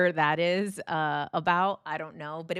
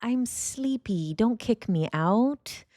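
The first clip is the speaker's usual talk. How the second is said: slow, higher, drowsy little cat voice; sleepy cat voice; 6 semitones higher, much slower than usual. Retold or imitated. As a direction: voice the cat as if it were half asleep. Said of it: "It's like".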